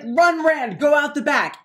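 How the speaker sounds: high, loud warning cry; shouted order to flee; urgent shout. Acted. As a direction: yell over the fight. Tam fighting monsters.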